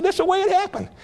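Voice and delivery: teasing high-pitched defence; playful high protest. Retold or imitated. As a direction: protest your innocence with a grin. Grandpa's defiant reply.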